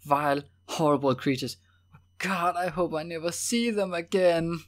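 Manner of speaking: high, loud, anguished loathing; bitter. A narrator's reading. Evelyn hopes never to see them again, bitter. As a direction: burst out in bitter disgust. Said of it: "she said bitterly".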